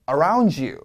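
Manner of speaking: around you said as aroun-ju; D and Y linked as j. Shown as a demonstration. Teacher models the linking for students to repeat. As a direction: blend d and y into j. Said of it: "한 번 더".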